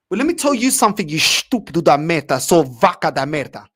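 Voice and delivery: loud, fast-starting angry tirade; furious outburst. Real, not acted. Fan raging at a manager over a dodged question, furious. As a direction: lay into him at full volume.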